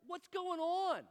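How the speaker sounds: high, loud, drawn-out question; exasperated outburst. Acted. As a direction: belt it out slowly, way up high. Comic bewildered outburst.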